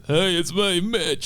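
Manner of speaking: a light put-on voice for Mitch; Mitch impression. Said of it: "We've got Mitchell Gosling".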